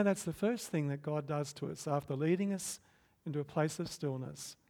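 ordinary delivery

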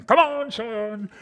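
slow, loud megaphone cheer. Retold or imitated. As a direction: call it out across the field.

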